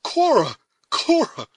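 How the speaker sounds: high, loud, pleading repetition; indignant cry. A narrator's reading. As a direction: call her name in dismay. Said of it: "the indignant and astonished youth".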